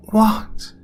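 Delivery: high, breathy whispered plea; frightened woman begging the man to answer; pleading. A narrator's reading. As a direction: beg him in fear. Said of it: "she pleaded".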